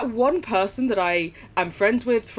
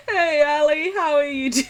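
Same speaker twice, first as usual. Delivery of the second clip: laughing greeting; 6 semitones higher, 4 dB louder than usual. Real, not acted. Greeting friend warmly.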